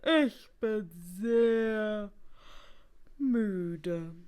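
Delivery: words dragged slowly through a yawn; loud, weary yawn on every word; yawning, very tired. A narrator's reading. Exhausted hotel guest telling the receptionist, yawning. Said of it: "sagte Herr Schnarch und gähnte".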